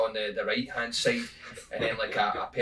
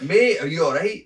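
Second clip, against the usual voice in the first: higher, louder concerned call; 6 semitones higher, 9 dB louder than usual. Retold or imitated. Storyteller calling out to a man lying in mud. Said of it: "I'm like".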